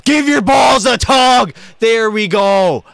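high, loud, slow taunting shout; triumphant shout. Real, not acted. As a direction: bellow it after flattening the opponent. Triumphant shout after big hit.

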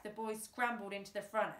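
ordinary delivery

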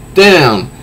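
usual speaking voice

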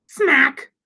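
usual speaking voice

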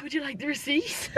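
softly imitated, bad Scottish accent; quiet mock-Scottish question. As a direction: have a poor go at a Scottish waitress. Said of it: "that was a bad accent".

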